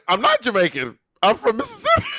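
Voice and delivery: high-pitched mock protest; indignant mom. Comic retelling of mom correcting the lady, mock indignant. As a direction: imitate a baffled, insistent mother.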